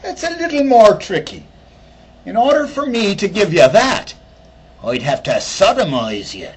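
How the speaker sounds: Irish leprechaun. Retold or imitated. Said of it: "says the leprechaun".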